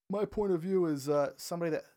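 talking through a yawn; stifle a yawn as you speak. Real, not acted. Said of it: "You just yawned".

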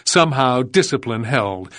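plain reading voice

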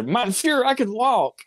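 high, straining exclamation; imitated movie outcry. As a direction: cry it out high, astonished. Impersonates a movie character's outcry.

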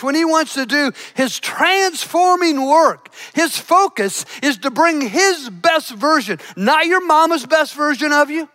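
normal talking voice

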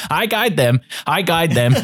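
overjoyed fan shouting from the crowd; jumping for joy. Acted. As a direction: bounce up and crow it twice. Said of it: "jumping up in the air and he says".

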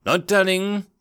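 high, lilting sing-song. A narrator's reading. Daring refusing to give the elf a name, in a sing-song. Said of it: "the Daring said in a sing-song".

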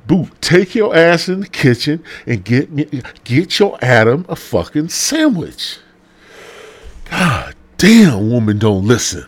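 loud, high, fired-up sermon voice; hammed-up pulpit preaching; mock preacher. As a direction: preach it to the congregation like a rowdy pastor. Mock pastor ranting.

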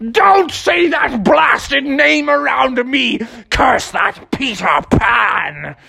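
slow, high-pitched pirate bluster; hammed-up villain curse, words drawn out; furious Captain Hook. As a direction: rant like a pantomime pirate captain. Hook rages at Smee.

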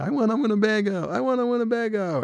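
put-on high, breathy-free childlike chant; Bill's excitement. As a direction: wish it out like a kid.

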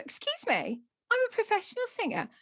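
high-pitched affronted retort; mock indignation. Singer defends herself to small daughter, mock indignation. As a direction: protest it in comic affront.

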